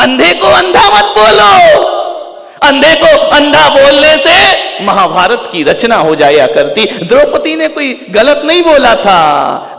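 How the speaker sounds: high, shouted warning with stretched words; full-force preaching. Real, not acted. Preacher warning against calling the blind blind, full-force preaching. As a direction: cry out the warning to the crowd.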